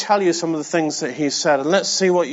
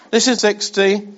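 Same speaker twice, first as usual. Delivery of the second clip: usual speaking voice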